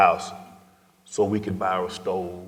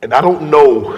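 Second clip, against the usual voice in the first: louder and higher, voice bursting out; emotional outburst; 7 semitones higher, 12 dB louder than usual. Real, not acted. Telling the host of grandmother's last days, raised voice. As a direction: let the feeling break through.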